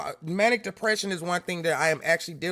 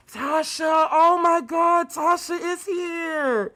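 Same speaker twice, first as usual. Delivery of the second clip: delighted surprise; 7 semitones higher, much slower than usual. Real, not acted. Surprised by a viewer's arrival.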